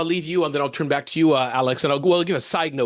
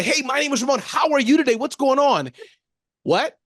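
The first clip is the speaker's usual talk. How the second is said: peppy caller voice; 8 semitones higher than usual. Retold or imitated. Ramon replaying his overly cheery pizza order call. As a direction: overdo the cheer like a spammer. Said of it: "And I".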